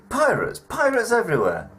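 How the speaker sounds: high, alarmed cry of pirates; excited. Acted. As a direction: exclaim it in mock panic.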